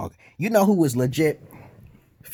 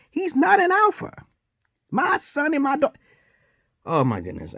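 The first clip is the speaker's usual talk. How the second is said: ordinary talk, nothing marked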